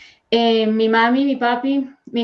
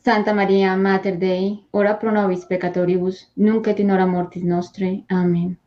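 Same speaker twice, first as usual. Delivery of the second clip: calm, devout chanting of the second half; Latin prayer recitation; 2 semitones lower than usual. Acted. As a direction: answer the prayer reverently. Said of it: "tú haces la segunda". (rezo devoto en latín)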